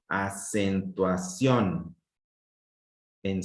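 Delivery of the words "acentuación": "Acentuación" very slowly, in separate syllables. Shown as a demonstration. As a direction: draw out "Acentuación" low, piece by piece, so the stressed syllable can be found. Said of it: "en qué sílaba estuvo la mayor intensidad". ("Acentuación" muy lento, sílaba por sílaba)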